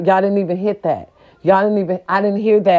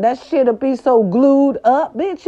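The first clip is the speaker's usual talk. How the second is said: ordinary delivery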